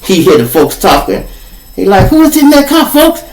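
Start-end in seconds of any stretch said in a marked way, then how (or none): 2.1-3.2 sharp high call of who's there; pitched-up wary shout; suspicious call-out. Retold or imitated. Dealer calling out suspiciously.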